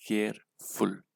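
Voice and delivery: "Careful" split slowly into care and फुल; "careful" in two separate beats, care, फुल; syllable by syllable. Shown as a demonstration. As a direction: count out both syllables of "careful" with a gap. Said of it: "दो syllable का".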